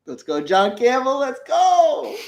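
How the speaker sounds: high, loud hype yell; excited cheer. Real, not acted. Cheering the new member.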